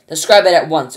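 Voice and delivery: loud, commanding demand; ordered. Acted. Ground control ordering Shuckworth to report, loud and commanding. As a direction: issue it as a firm command. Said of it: "ordered ground control".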